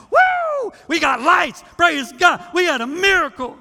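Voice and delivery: loud high jubilant mock shouting; shouting praise. Preacher mimics congregation cheering over the lights, loud high jubilant shouting. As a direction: whoop it out like a church that just got lights. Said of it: "we start shouting".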